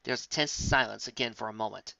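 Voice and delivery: ordinary delivery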